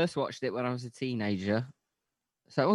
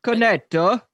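high, loud mock Italian; mock Italian accent; 9 semitones higher, 8 dB louder than usual. Co-host testing Italian words on the host, mock Italian accent. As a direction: belt it out like a cartoon Italian. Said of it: "That's Italian, isn't it?".

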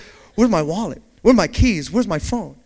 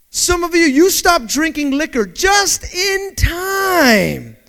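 preaching shout; 8 semitones higher, 5 dB louder, slower than usual. Acted.

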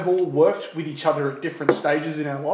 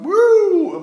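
high, loud "woo"; imitated whoop; 7 dB louder than usual. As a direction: let out a high-pitched woo. Imitating a siren while driving along.

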